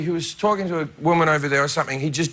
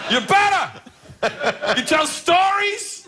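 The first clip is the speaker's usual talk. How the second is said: high, excited Pacino impression with laughter breaking in; hammed-up Pacino; 10 semitones higher, slower than usual. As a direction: gush like a theatrical Pacino. Pacino praising the comedian.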